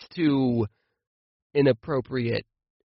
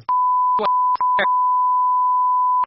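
loud, much higher cackle cutting off the insult; high burst of laughter; 4 dB louder than usual. Real, not acted. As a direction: crack up mid-swear. Cut-off swear at mom.

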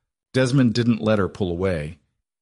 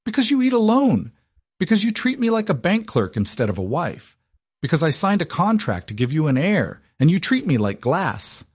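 raging at her husband, much higher; yelling, composure snapped; 9 semitones higher than usual. A narrator's reading. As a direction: shout every because at him. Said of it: "her composure entirely snapping".

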